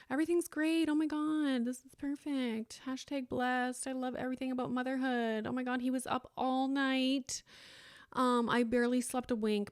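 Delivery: high, gushing mock-bubbly voice, sweet and fake; fake cheerful social media voice. Mom mocking social media posts, fake cheerful gush. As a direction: overdo the perfect-mom cheer like a post. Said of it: "put on a show".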